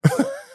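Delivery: short high-pitched laugh; real laugh. Real, not acted. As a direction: let a high little laugh escape. Laughs at own steak story.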